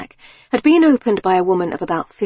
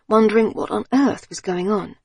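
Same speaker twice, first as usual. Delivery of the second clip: ordinary delivery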